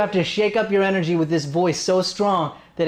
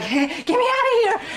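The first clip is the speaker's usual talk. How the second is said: much higher, squeaky imitation; imitating Aubrey. Coach imitates Aubrey wanting to get out of the awkward scene. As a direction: mimic her awkward plea to escape. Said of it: "Aubrey's like".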